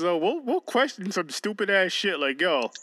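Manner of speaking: ordinary talk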